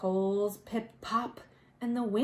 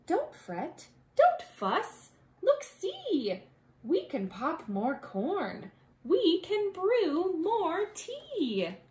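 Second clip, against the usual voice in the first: higher little mouse comforting; soothing mouse squeak; 7 semitones higher than usual. A narrator's reading. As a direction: reassure the big bear in a small squeaky voice. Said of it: "Mouse squeaks".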